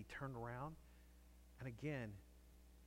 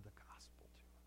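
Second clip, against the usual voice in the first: whispered, much softer; hushed whisper; 15 dB quieter than usual. Real, not acted. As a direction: lean in and whisper the point. Hushed punchline in sermon.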